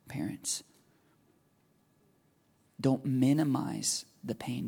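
ordinary talk, nothing marked

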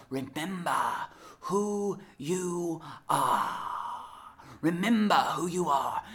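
slow, drawn-out prophetic voice; Rafiki. Acted. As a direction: stretch the words like a solemn message. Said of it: "this is the message that he gives to Simba".

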